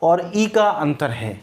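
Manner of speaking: ई stressed slowly, का falling; slow it down and lean on ई; contrast stress. Shown as a demonstration. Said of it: "ए का".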